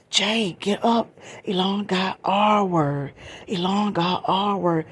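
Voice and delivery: loud, slow, high calling out; retold alarmed shouting, drawn out; urgent alarm. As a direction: imitate her desperately waking someone, words stretched. Goddaughter alerting the sleeping cousin.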